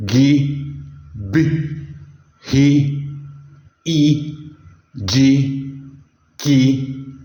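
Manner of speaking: each letter of the Yoruba alphabet named slowly and distinctly, one by one; Yoruba alphabet recital. Shown as a demonstration. Teacher reciting the Yoruba alphabet to students, slow and clear. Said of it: "Vamos conhecê-las".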